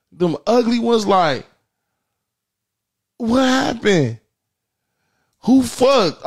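high, drawn-out disbelief; incredulous. Acted. Teasing ugly girls, high incredulous squeal. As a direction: gasp it out in disbelief.

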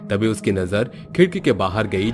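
ordinary delivery

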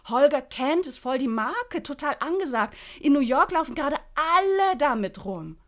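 high, loud, gushing sales pitch; enthusiastic Freddy. Acted. Freddy pitching the mini dress to Lynn, high and gushing. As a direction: sell the dress like it's the best thing ever.

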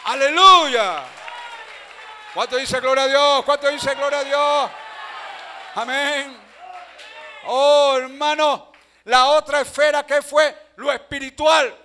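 loud, high, shouted preaching; prédica a toda voz. Real, not acted. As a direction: rouse the congregation at full force. Preaches at full force. (predicación gritada, fuerte y aguda)

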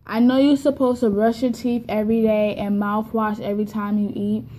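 usual speaking voice

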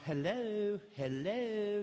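lightly perky wife answering; perky hello. Retold or imitated. Wife answering unknown caller, lightly perky. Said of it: "you're real perky".

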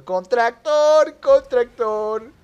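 high, loud, very drawn-out words; loud calling out. Real, not acted. Player announcing he goes with a tractor, long loud cry. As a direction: stretch it out in a loud call.

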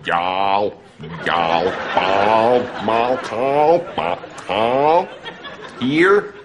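practising a southern drawl word by word; southern American accent. Shown as a demonstration. Warming up for the sketch, southern accent drill. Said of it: "Can you do a southern American accent?".

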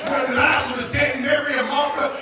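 loud, building preacher's climax; full-force preaching. Acted. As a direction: preach it hard and rising to the rafters.

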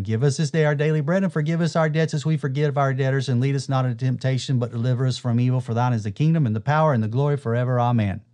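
fast, rattled-off recitation; rote recital. Shown as a demonstration. Preacher demonstrating mindless rote prayer, reciting fast. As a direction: rattle it off like a memorized chant. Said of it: "I'm going to be talking out loud, reciting it, talking to myself".

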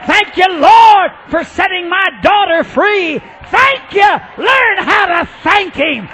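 high, loud, driving sermon shout; full-force preaching. Real, not acted. Preacher teaching people to thank Jesus, fervent shouting. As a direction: shout it in rhythmic bursts to the congregation.

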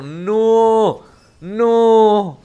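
loud, high, despairing no; anguished shout. Real, not acted. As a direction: cry out as the play falls apart. His teammates misplay the fight.

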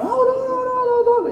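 very high, loud mock panic; panicked no's. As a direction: squeal no over and over like someone losing control. Staff panicking over the case. (noes agudísimos de pánico fingido)

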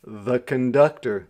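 ordinary delivery

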